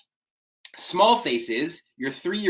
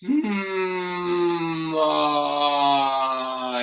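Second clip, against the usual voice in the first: hum into ah with a crack. Shown as a demonstration. Coach warming up her own voice, hum to cracking ah. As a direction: let the voice crack on the ah. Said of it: "you can hear my voice crack".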